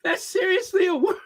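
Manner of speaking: high squeal on the edge of laughter; laughing disbelief. Real, not acted. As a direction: squeeze it out before cracking up.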